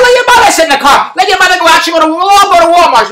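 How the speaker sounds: re-enacted high-pitched squabble; mock-indignant comeback; argumentative. Retold quarrel with partner.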